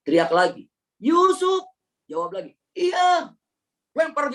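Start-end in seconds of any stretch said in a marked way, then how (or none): 1.0-1.6 much higher, loud call down a well. Acted. Calling down the well. 2.7-3.4 much higher, small answering voice; young boy calling up; answering from below. Acted. Yusuf answering from the well.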